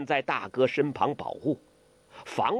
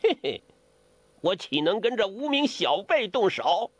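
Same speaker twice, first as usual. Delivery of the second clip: scornful sneer, pitched up; a dry chuckle, then high disdain; cold laugh; 6 semitones higher than usual. A narrator's reading. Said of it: "梅超风冷笑". (冷笑，不屑地说)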